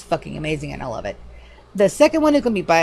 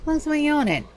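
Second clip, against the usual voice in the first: usual speaking voice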